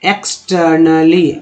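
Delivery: "एक्सटर्नली" slowly as eks-tur-na-lee; model pronunciation. Shown as a demonstration. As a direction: break "एक्सटर्नली" into slow parts. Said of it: "ईआर को आर बोलते हैं".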